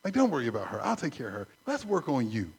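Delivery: voiced low and soft, gently correcting; calm divine reproof, spoken low; a soft, low fatherly correction; gentle God. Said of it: "he reminds me gently".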